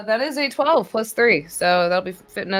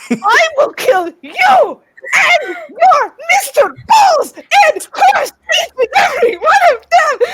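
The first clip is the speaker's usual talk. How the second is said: much higher, much louder raging scream; furious shout; 9 dB louder than usual. Acted. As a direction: scream the threat with pure rage. Grendel screams threat at creature.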